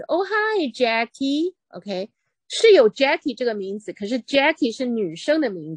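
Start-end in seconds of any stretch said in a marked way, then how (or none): 0.0-1.6 higher, slow sing-song mom greeting; lightly voiced mom calling her son. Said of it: "他妈就叫这个孩子". (妈妈拖长声调叫儿子)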